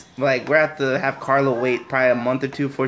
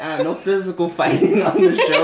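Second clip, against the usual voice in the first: laughing while talking; 5 semitones higher, 4 dB louder than usual. Real, not acted. Host laughing.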